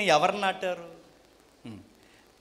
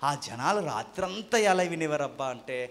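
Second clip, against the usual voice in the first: usual speaking voice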